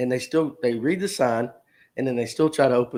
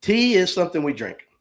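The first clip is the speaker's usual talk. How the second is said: usual speaking voice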